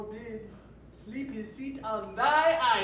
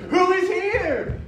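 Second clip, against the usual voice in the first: surprised; 8 semitones higher, 11 dB louder than usual. Acted. Puck discovers sleepers.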